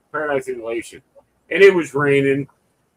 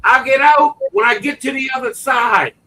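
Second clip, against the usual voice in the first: defiant shout back; 8 semitones higher, 4 dB louder than usual. Retold or imitated. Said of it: "all I heard this woman says".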